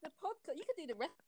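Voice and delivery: high-pitched, breaking into real laughter; cracking up. Real, not acted. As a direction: try to talk while helplessly laughing. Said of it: "I don't know why I'm cracking up".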